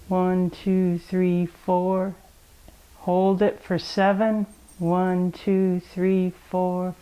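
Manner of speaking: soothing yoga breath count; calm breathing count. Acted. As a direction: count the breaths gently for the kids.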